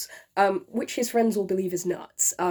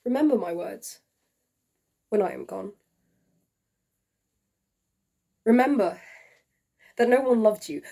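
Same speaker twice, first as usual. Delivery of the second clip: tragic love letter. Acted. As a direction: read it like a dying man's farewell. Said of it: "performed by yours truly".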